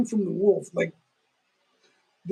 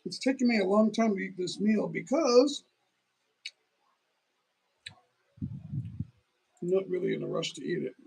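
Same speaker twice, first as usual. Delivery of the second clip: mouth full. Real, not acted. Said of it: "I don't like talking with my mouth full".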